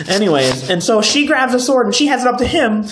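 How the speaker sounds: usual speaking voice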